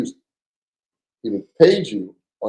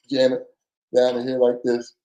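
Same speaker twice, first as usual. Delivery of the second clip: usual speaking voice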